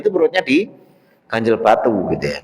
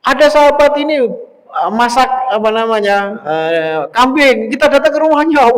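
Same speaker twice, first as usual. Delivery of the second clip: high, loud calling-out to a crowd; retold shout; 9 semitones higher, 3 dB louder than usual. The Prophet invites everyone to the companion's meal, shouted announcement. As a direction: imitate an excited public announcement. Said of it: "malah teriak gitu".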